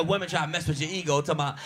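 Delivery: ordinary delivery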